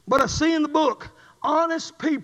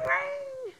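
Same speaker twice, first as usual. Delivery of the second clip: phone ring imitation; 12 semitones higher, 7 dB quieter than usual. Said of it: "all of a sudden, ring".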